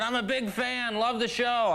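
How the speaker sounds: high-pitched holler from a distance; imitated yelling. Homeless fan praises comedian from the bench, mock yell. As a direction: mimic a fan calling across the street. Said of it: "this homeless guy across the street sitting on a park bench yells".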